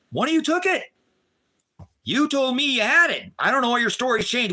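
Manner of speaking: high, heated accusation; accusing. Retold or imitated. To the man who took his silver, a high, heated accusation. Confronting the guy.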